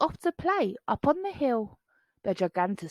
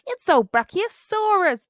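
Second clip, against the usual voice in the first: laughing; 8 semitones higher, 6 dB louder than usual. A narrator's reading. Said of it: "Bonehead laughed".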